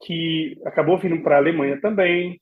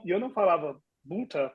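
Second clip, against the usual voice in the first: ordinary talk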